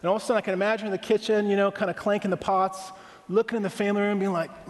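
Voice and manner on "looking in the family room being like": ordinary delivery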